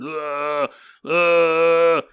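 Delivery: mock ritual chant grunts; imitated chant grunts. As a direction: hum it like a creature's chant. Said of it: "saying this chant".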